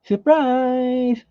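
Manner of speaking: a high, playful "Surprise!"; spring it on them like a little joke; mock surprise. Playful exclamation while painting.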